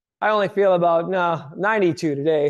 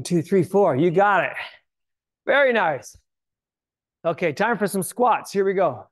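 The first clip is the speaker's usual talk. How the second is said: upbeat workout coach calling moves; aerobics instructor. Acted. Energetic workout cueing.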